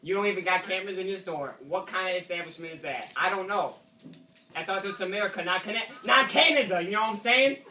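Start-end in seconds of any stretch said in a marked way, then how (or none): 4.5-7.6 indignant outburst. Acted. Indignant rant at clerk.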